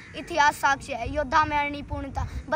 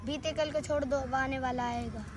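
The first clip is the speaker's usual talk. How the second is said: soft verse lead-in; hushed recital; 2 semitones lower, 6 dB quieter than usual. Acted. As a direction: recite it quietly, held back. Soft first take of verse. (धीमे स्वर में कविता)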